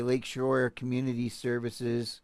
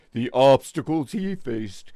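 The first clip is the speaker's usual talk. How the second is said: mock dramatic; 4 dB louder than usual. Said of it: "I can't read this".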